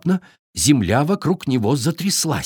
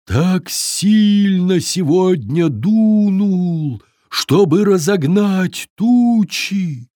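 slow, louder windy voice; droning wind; 6 semitones higher, 4 dB louder, much slower than usual. A narrator's reading. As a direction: stretch the words like wind. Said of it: "прогудел ветер".

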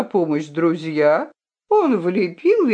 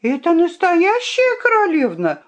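high, insistent exclamation; the fox protesting to the knight; indignant exclaim; 10 semitones higher than usual. A narrator's reading. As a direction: insist she's truly royal. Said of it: "воскликнула хитраска".

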